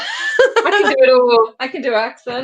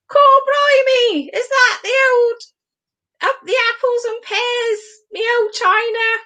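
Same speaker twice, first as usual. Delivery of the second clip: put-on London accent; 10 semitones higher than usual. Listeners told to close their eyes, mock London accent. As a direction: do a hammed-up high Cockney voice. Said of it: "I'll do it properly".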